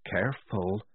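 soft, discreet warning; just audible. A narrator's reading. Polly warning Tonka under her breath, hushed. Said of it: "said Polly, just loud enough for Tonka to hear".

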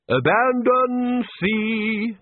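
high, long-drawn merry call; cheerful shout. A narrator's reading. Bursar gleefully calls out amid the shipwreck, cheerful shout. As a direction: sing it out slowly and happily. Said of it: "shouted the bursar cheerfully".

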